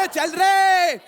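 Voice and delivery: high, loud, blustering order; bullying shout. Acted. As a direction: bluster loudly to regain control. Thug tries again to intimidate. (ऊँची आवाज़ में धौंस भरी डाँट)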